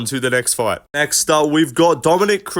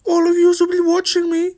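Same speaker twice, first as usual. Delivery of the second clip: mock near-tears. Mocking Ian Garry getting booed, whiny near-tears. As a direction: squeak it out like you're about to cry. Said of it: "sounded like he was about to burst out crying".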